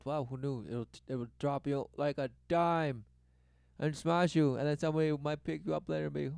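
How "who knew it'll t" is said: ordinary talk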